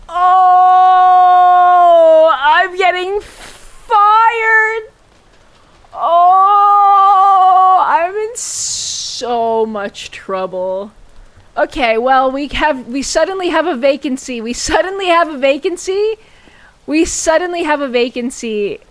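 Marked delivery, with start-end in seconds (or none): 0.1-10.9 panicked. Real, not acted. Panics after killing wrong patient. 12.9-18.8 fake cheerful. Covering up mistake cheerfully.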